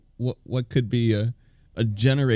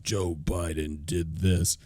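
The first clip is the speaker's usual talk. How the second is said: mock ad narrator; 8 semitones lower, 5 dB quieter, slower than usual. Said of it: "The ad can just be ... nothing like".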